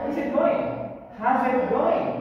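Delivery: "how's it" linked with a buzzing Z; linked Z sound. Shown as a demonstration. Teacher models question for class, "how's it" linked with Z. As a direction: join "how's" to "it" on a Z. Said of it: "Ini lebih ke Z ya".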